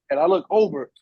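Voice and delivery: ordinary delivery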